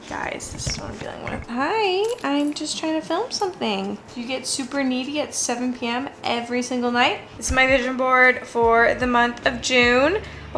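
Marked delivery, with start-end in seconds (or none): 0.1-1.5 unvoiced exasperated whisper. Real, not acted. Aside about the needy dog. 1.5-7.2 pet talk. Real, not acted. Talking to the needy dog.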